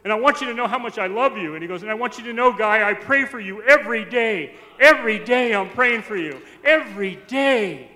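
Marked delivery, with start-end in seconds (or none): 0.0-1.5 loud, high outpouring of love; booming, heartfelt mimicked declaration; imitated fervour. Uncle's fervent declaration, retold. 1.8-7.9 loud, high, emphatic vow; booming, fervent mimicked declaration; imitated fervour. Uncle's fervent declaration, retold.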